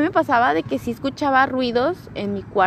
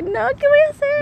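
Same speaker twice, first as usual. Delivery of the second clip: much higher squeaky panic; mock panic; 13 semitones higher than usual. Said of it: "yo estaba así como de que". (pánico fingido muy agudo)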